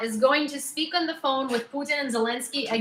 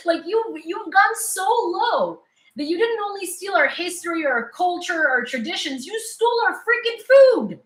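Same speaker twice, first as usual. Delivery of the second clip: high-pitched, rising indignant rant; heated rant; 8 semitones higher than usual. Real, not acted. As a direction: pile up the grievances, climbing higher. Laughing rant at Russia.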